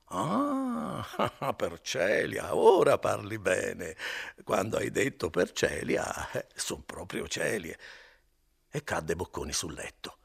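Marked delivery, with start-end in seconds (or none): 0.1-7.8 put-on drunken laugh. Drunk Renzo laughs off the joke.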